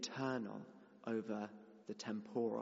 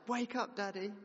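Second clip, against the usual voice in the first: put-on high little-boy shout; child shouting; 9 semitones higher than usual. Toddler shouting to wake his sleeping father. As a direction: call out like a toddler waking his dad. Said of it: "he shouts".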